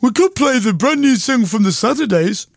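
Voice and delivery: lightly mimicking the co-host's pitch; mocking imitation. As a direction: echo his suggestion in a sneering voice. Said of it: "If it was one show".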